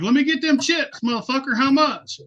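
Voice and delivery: high-pitched eager buyer's call; retold eager hailing. Hailing a geeker selling bags of chips, eager call.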